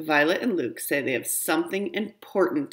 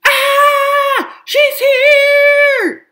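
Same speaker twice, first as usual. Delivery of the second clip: much higher, much louder squeal of fright; panicked shriek; 12 dB louder, much slower than usual. Acted. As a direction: scream the alarm and run. Doorbell rings, child panics.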